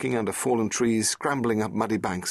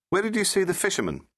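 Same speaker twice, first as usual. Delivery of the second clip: put-on higher, lively young woman; Rose's voice; 13 semitones higher, faster than usual. Rose asking the Doctor about the sea, a young woman's voice.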